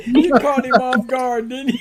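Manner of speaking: loud, high, slow laughing tease; laughing while talking. Real, not acted. Teasing a friend caught off guard, loud laughing voice.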